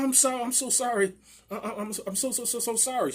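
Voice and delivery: high-pitched stammered apology; mock stuttering. Neighbour apologizing for the knife incident, stuttering. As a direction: trip over the words, imitating her fluster. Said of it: "She started stuttering".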